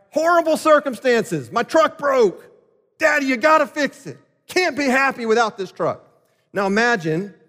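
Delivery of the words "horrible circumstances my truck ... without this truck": higher, whiny mock wail of a small kid; crying little boy. Eight-year-old begs his parents to fix the truck, whining. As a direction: plead like a boy who broke his toy. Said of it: "starts crying, wailing".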